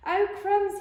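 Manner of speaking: high, loud yelp; startled snake. Acted. Snake takes one look at the Gruffalo, startled. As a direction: cry out in sudden alarm.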